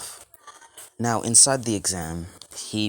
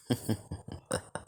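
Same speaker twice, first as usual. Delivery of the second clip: soft, ominous villain laugh; quiet chuckle from the shadows; hushed, menacing snicker; sinister chuckle; 4 semitones higher, 5 dB quieter than usual. Acted. Ominous figure leaves the shadows.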